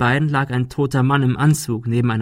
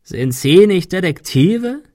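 loud, slow croaking question, pitched high; 7 semitones higher, 5 dB louder, slower than usual. A narrator's reading. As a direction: croak it out scornfully. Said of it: "krächzte er". (krächzend, laut, langsam fragend)

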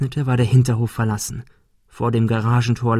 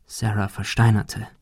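usual speaking voice

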